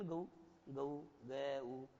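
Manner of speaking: slow, low "गऊ" gliding into oo; diphthong GO. Shown as a demonstration. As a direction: draw "गऊ" out as guh-oo. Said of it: "शुद्ध यही होता है".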